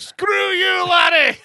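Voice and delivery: put-on high, shouted Mike Myers-style Scottish accent. Host shouting a caller's line on air, Scottish accent. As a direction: yell it in a hammed-up Scottish accent. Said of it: "every time I do a Scottish accent".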